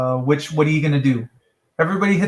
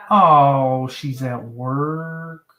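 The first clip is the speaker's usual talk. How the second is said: slow realization; 3 semitones lower, much slower than usual. Real, not acted. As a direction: let it dawn on you slowly. Realizing, slows down.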